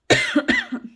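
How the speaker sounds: sharp dry cough; a single rough cough. Real, not acted. Sick speaker coughing mid-video.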